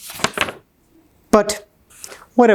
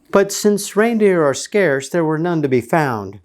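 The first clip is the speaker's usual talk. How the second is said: plain reading voice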